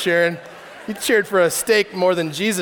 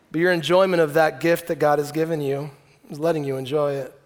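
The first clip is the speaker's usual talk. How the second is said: ordinary delivery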